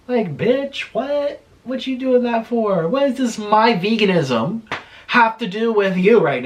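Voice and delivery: high, heated rant, slowing to jab each word; indignant outburst pitched well up; getting heated. Real, not acted. Ranting at a critic who dug up her past, heated. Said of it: "I'm getting heated".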